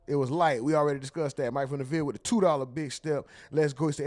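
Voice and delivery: ordinary delivery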